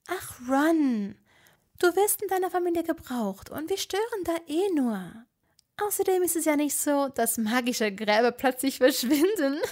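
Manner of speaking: light teasing Hermine; lightly voiced Hermine, joking. A narrator's reading. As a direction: soothe him with a grim joke.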